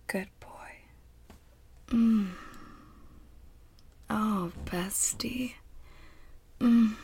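intimate ASMR whisper; hushed and close to the mic. Acted.

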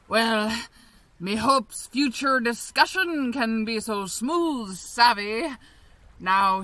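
ordinary delivery

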